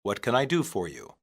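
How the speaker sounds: normal talking voice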